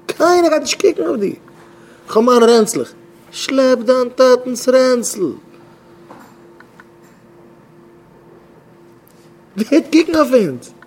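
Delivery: high, loud exhortation; scolding outcry. Acted. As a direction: cry it out sharply to the crowd. Acted scolding about dragging father's bag.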